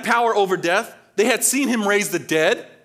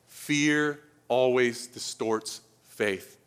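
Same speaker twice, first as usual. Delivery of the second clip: very slow, weighty; 6 semitones lower, 5 dB quieter, much slower than usual. Shown as a demonstration.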